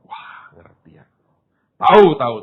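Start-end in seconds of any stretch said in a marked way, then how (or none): 0.1-1.0 whisper. Retold or imitated. Retold reply to God.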